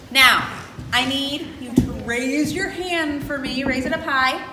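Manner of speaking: loud, projected crowd instruction; loud teacher addressing an assembly; calling out instructions. Real, not acted. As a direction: project it to the whole room.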